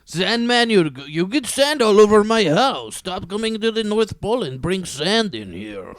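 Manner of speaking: put-on high, loud Russian accent scolding; a mock-angry Russian Santa complaining. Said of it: "That's my Russian Santa Claus".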